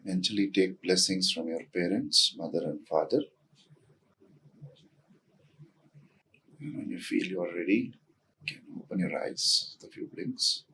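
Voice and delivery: soft, hushed grounding voice with long pauses; guided meditation. Real, not acted. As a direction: guide them gently inward, quietly, leaving space between phrases. Leads a short grounding.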